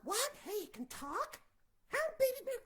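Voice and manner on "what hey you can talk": ordinary delivery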